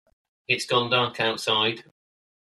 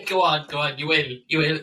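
put-on Father Ted accent. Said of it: "in a Father Ted accent".

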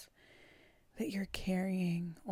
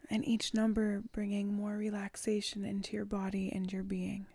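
normal talking voice

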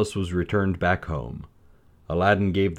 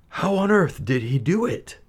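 much higher, astonished king; amazed Sultan; 11 semitones higher than usual. A narrator's reading. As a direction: gasp it out in wonder.

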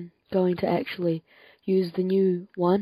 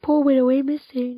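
mock pity; 6 semitones higher, 5 dB louder than usual. Teasing his shy friend.

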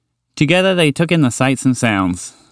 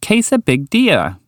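high, slow, emphatic greeting; pleased eagerness; 6 semitones higher, slower than usual. Acted. Walter happy to be heard by the horse, pleased emphasis. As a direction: draw it out, glad to be heard. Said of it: "happy to be heard".

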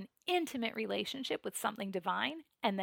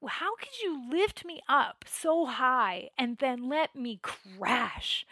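mad outburst; 3 semitones higher, 6 dB louder than usual. Retold or imitated. Meditator blaming God for letting her crash, angry pleading. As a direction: act out reproach, stretching the key words. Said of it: "and I got mad".

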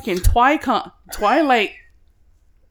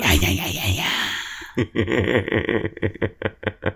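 howling laugh; 13 semitones lower than usual. Real, not acted. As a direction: cackle out in wild howls. Laughing at the dog.

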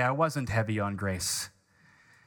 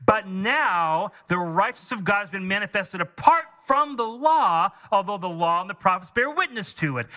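full-force preaching; 6 semitones higher than usual. Acted. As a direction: preach it to the church, building fervor.

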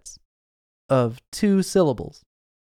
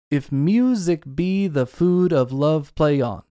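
slow, beat-by-beat da-DUM reading of "If music be the food of love"; iambic recitation; slower than usual. Shown as a demonstration. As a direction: spell out each iamb, weak then strong. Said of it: "to illustrate to your ears".

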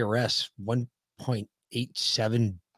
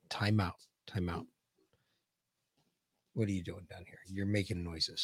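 quiet aside; 7 semitones lower, 13 dB quieter than usual. Real, not acted.

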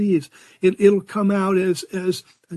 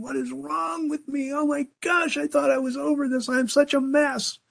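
higher and softer, a mock wail of dismay; acting out a griever's high, breathless inner panic; panicked self-talk; 6 semitones higher, 4 dB quieter than usual. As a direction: fret aloud like someone falling apart. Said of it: "and you're thinking".

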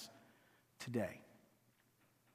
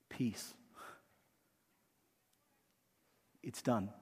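quiet word of peace; 3 semitones lower, 7 dB quieter, slower than usual. Pretend. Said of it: "he's literally saying to people".

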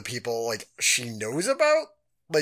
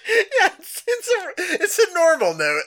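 high laughing words; 5 dB louder than usual. Real, not acted. Laughing at the joke.

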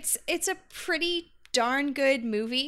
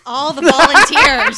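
much louder, laughing through the words; louder sarcastic laugh at a room with no volunteers; laughing sarcasm; 3 semitones higher, 14 dB louder than usual. Real, not acted. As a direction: crack up while mocking the silence. Teasing nobody wants to go.

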